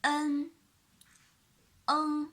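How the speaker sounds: "en" drilled for copying; clear demo syllable. Shown as a demonstration. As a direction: model "en". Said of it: "and the next one".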